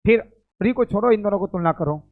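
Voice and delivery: ordinary talk, nothing marked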